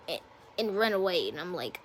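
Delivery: ordinary delivery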